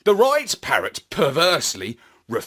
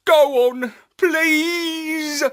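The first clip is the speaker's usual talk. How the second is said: much higher, louder begging; pleading Astropup; 12 semitones higher, 4 dB louder, much slower than usual. A narrator's reading. Astropup begging his parrot friend, higher and louder. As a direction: beg the old friend, pitch up and pushing.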